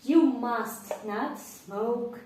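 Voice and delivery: very slow, every word separate; slow drill. Shown as a demonstration. Teacher drills the negative sentence very slowly. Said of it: "потренуємося".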